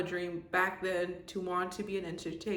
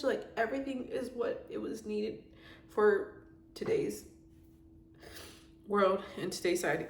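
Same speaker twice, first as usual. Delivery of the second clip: ordinary talk, nothing marked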